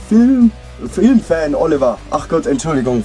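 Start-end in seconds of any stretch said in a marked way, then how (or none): 0.1-2.0 excited correction. Real, not acted. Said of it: "vor lauter Aufregung habe ich mich noch verlesen".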